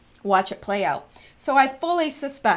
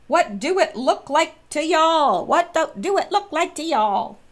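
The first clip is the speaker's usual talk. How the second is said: put-on Southern accent; 6 semitones higher, 4 dB louder than usual. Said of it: "that Southern accent".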